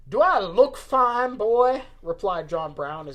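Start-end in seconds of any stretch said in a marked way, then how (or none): 0.1-1.8 loud, high Southern retort, slowly drawn out; Southern accent. Acted. Old man snaps back at concerned guard, loud Southern accent. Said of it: "Why have we made John Brown Southern?".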